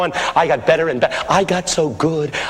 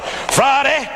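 higher, ringing pulpit cry; old pastor preaching; 6 semitones higher than usual. Retold or imitated. Old pastor answers shouts of preach, rising fervent preaching. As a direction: imitate a preacher building fervour. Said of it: "And he started".

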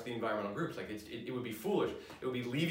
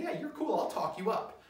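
easy, higher-pitched offhand endorsement; casual approval; 9 semitones higher than usual. Acted. Supporter casually agreeing to vouch for a candidate, breezy approval. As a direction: toss it off like a friendly supporter.

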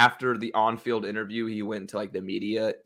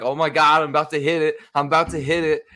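mock freaking out; 3 semitones higher, 4 dB louder, faster than usual. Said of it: "here I am freaking out like".

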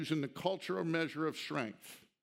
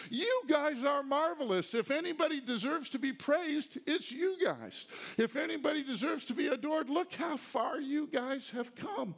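higher, gushing mock praise; sarcasm; 8 semitones higher than usual. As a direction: heap ironic compliments on them. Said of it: "Y'all do see the sarcasm here".

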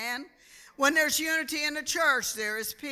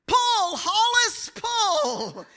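high, loud holler breaking into a laugh; farmer yelling; 9 semitones higher, 5 dB louder, much slower than usual. Retold or imitated. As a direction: yell at the mule while laughing. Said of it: "And then he yelled".

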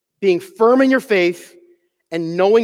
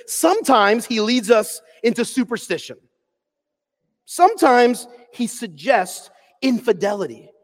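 preaching; 3 semitones higher, slower than usual. Acted. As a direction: proclaim it slowly, with weight. Continues reading Ryle quote.

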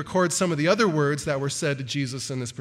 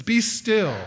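higher and a touch louder, firm rebuke; calm command; 5 semitones higher, 4 dB louder than usual. Acted. Jesus rebuking the wind and the sea, firm command. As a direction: order the storm to stop. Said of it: "He says".

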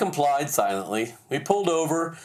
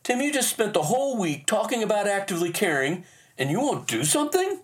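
high, incredulous question; retold indignant challenge rising at the end; challenging wife; 7 semitones higher than usual. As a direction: voice a wife calling him out, pitched up. Wife challenges him in story.

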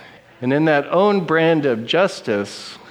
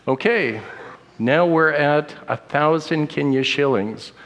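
ordinary talk, nothing marked

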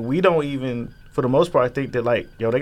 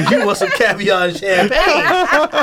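high, slow, laughing fake-posh offer; laughing posh mockery; 7 semitones higher, 4 dB louder, slower than usual. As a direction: lay on the fancy talk while laughing. Mocking posh stereotype, laughing.

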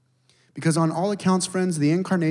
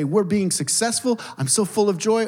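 mock cheerful; 7 semitones higher, 3 dB louder than usual. Contrasting happy vs depressed voice.

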